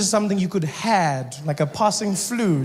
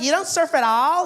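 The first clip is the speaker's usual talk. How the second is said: put-on valley girl voice; 10 semitones higher than usual. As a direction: play the ditzy Malibu surfer girl. Surfer girl asks.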